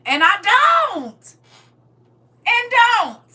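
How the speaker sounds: high and loud, slow and drawn out; emphatic loud refusal. Real, not acted. Refusing to kiss anyone she can't trust, loud and slow. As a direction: slam each word down.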